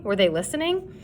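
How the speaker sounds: usual speaking voice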